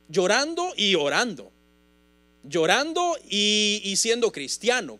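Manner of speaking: repetición fuerte y marcada de llorando; predicación enérgica. Acted. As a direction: predica con fuerza cada frase. Preaching at full force. (predicación a pleno pulmón)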